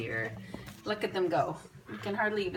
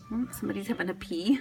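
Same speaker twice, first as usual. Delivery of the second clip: normal talking voice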